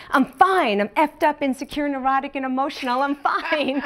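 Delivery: high, mock-exasperated retort tipping into laughter; pissed-off outburst. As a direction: reenact snapping under repeated questioning. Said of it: "I got so pissed and I said".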